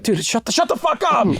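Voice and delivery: high-pitched mock irritation; annoyed audience member. Moviegoer telling heckler off, mock annoyed.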